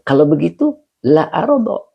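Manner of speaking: mild pleading voice for the Prophet; the Prophet's words. Retold or imitated. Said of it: "itulah hebatnya baginda Nabi".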